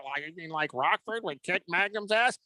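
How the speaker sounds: querulous mock old man, rising into a question; nursing home old-timer. Old friends bickering over Magnum and Rockford, mock old-man voice. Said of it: "that'll be us at the, uh, nursing home".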